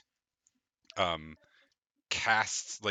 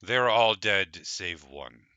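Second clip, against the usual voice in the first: slow, scornful ancient dragon; disdainful dragon; 3 semitones lower than usual. Acted. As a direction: sneer down at mere mortals, slowly. GM voices the dragon.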